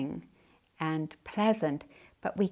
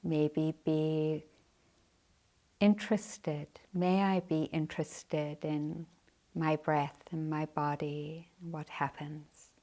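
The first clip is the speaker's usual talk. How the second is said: guided meditation. Acted. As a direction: guide the sitters gently into practice.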